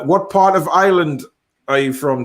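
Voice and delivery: normal talking voice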